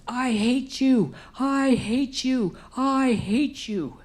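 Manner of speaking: high, slow, furious yell at God; raging yell. Retold or imitated. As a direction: scream it out with all the rage. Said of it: "yelling to the top of my lungs".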